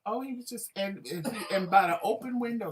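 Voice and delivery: usual speaking voice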